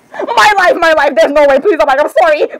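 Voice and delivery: high, pleading gush; excited outburst. Acted. Character overwhelmed by someone famous, excited gushing. Acted fangirl outburst in roleplay.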